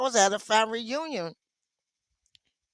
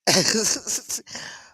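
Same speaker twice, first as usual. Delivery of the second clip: amused laughing fit; real laugh; 3 semitones higher than usual. Real, not acted. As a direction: burst of helpless laughter. Cracking up at viewer comments.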